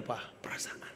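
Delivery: whispered hint. Retold or imitated. Wife hinting at mall.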